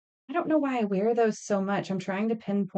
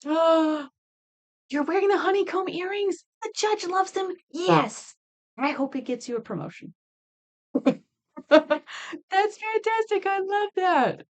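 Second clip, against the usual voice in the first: high, bright-eyed delight at the earrings; 10 semitones higher than usual. Real, not acted. Thrilled maker greeting a customer wearing her earrings. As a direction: gush over a customer's honeycomb earrings.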